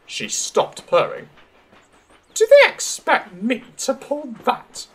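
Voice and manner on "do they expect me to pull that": high-pitched haughty outrage; indignant. A narrator's reading. Daisy is asked to pull a van, indignant. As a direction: ask it in high, offended disbelief. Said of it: "she asked indignantly".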